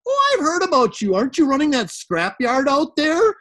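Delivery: high, loud put-on voice of a chatty bank clerk; bank lady's voice. Bank lady greets the farm owner, high and loud. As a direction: chirp it like a nosy small-town teller. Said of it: "the lady at the bank was just like".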